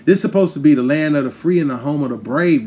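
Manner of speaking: usual speaking voice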